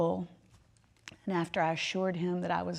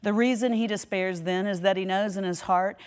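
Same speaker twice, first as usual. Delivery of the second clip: quote reading; 5 dB louder than usual. Acted. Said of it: "he puts it this way".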